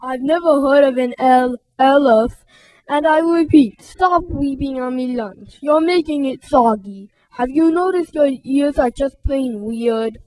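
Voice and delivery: stomp and mock like a bully. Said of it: "Rudy jumped up and down".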